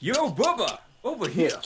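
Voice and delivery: high, loud playful holler; jokey shouted call; mock calling out. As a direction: hail a buddy across the way. Mock-calls someone over.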